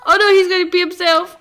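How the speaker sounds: high, loud shriek of horrified delight; shocked shriek. Real, not acted. Gamer squealing at her Sim wetting himself.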